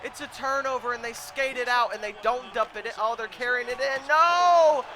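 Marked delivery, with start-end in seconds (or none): 4.1-4.8 loud, high cry of dismay; sudden alarmed yell; dismayed shout. Real, not acted. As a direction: shout in protest at the play. Dismay at opponent's rush.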